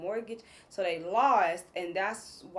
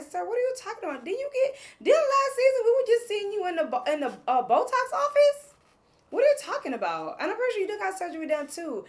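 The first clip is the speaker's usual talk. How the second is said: high, loud disbelieving outburst; can't believe what he's saying; shrieking disbelief at Jonathan; incredulous; 11 semitones higher, 6 dB louder than usual. Real, not acted.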